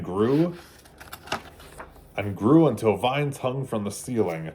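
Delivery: storybook read-aloud, gentle and lively; children's storybook narration. A narrator's reading. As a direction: read it like bedtime story time for kids. Reading picture book to children.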